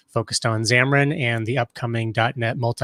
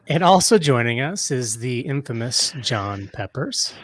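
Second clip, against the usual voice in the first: normal talking voice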